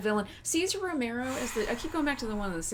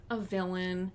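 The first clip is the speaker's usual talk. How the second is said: ordinary delivery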